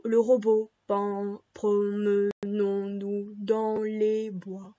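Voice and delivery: low, slow robot singing; slow mechanical low monotone. Shown as a demonstration. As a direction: intone it like a robot. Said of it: "en faisant le robot".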